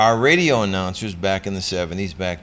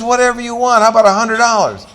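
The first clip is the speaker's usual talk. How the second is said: loud, high, fast dare; cocky challenge; 12 semitones higher, 8 dB louder, faster than usual. Retold or imitated. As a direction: toss out a bet with bravado. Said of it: "I says".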